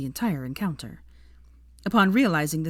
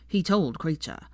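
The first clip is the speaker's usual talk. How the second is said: ordinary delivery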